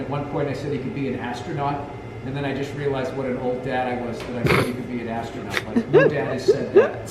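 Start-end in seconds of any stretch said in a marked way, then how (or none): 5.7-7.1 high, laughing through the words. Real, not acted. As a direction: laugh at yourself while saying it. Laughing at himself.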